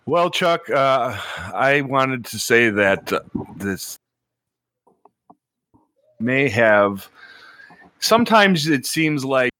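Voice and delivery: mic cutting in and out; glitching audio. Real, not acted. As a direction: voice dropping out on a bad mic. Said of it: "we may need to get you a new mic".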